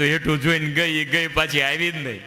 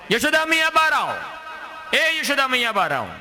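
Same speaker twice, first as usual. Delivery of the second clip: calling from afar; 12 semitones higher than usual. Acted. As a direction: shout it across the yard to bring her out. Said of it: "દૂરથી બૂમ પાડે".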